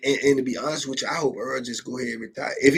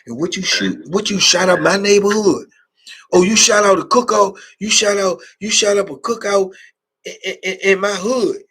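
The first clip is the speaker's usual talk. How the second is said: loud, higher mock confrontation, punching shot; mock angry taunt; 6 semitones higher, 8 dB louder than usual. Inmate confronting a new arrival about the shooting, loud mock taunt. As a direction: yell it like a convict confronting the shooter.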